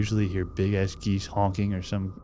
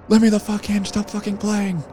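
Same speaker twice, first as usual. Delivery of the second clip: high, loud panicked scream through a door; full-force angry yell, much higher; 13 semitones higher, 8 dB louder than usual. Acted. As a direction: scream to be let in right now. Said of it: "He's screaming".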